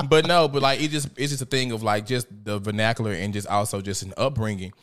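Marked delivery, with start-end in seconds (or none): none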